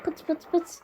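light mock popping sounds, pıt pıt pıt; imitated popping noise. Kid voicing the gun's pops while playing, imitated popping. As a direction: tap it out like little shots. Mimicking game sound effect.

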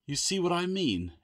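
a touch of a growl. A narrator's reading. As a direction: grumble it with mild irritation. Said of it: "Adrian growled".

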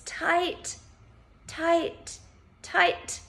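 high, effortful one-word commands; urging to tense. Shown as a demonstration. Instructor urging listeners to push fists down, urging to tense.

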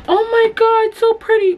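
very high, gushing mom voice; a high, breathless "so pretty". Retold or imitated. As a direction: squeal it like an excited mom. Mom gushing on first meeting.

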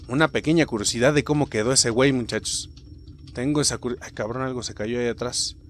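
ordinary talk, nothing marked

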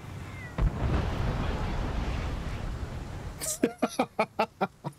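long, high, breath-heavy laugh; helpless laugh. Real, not acted. Gamer alone with a cruel game, laughing helplessly. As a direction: laugh helplessly until it breaks into cackles.

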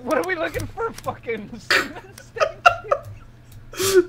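loud, rising yell of disbelief; incredulous shout. Real, not acted. Shouting at friend in game.

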